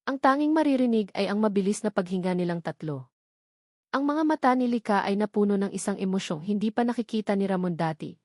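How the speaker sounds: ordinary delivery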